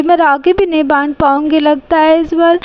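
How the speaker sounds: usual speaking voice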